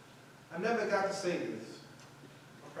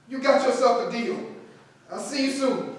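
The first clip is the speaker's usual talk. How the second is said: excited; 6 semitones higher, 8 dB louder than usual. Acted. Accepting deal excitedly.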